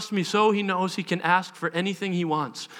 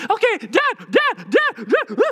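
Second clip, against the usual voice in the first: put-on insistent child; 12 semitones higher, 5 dB louder, slower than usual. Son insisting for attention.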